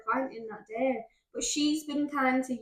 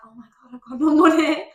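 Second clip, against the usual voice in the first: loud, high "oh my God" alarm; mock panic; 3 semitones higher, 9 dB louder than usual. Stylist retelling her panic at an empty account, loud and alarmed. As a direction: sound suddenly broke.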